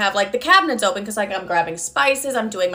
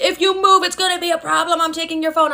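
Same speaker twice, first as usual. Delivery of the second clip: high-pitched retold parental threat; threatening father; 6 semitones higher than usual. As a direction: mimic dad laying down the law, pitched up. Voices father threatening her again.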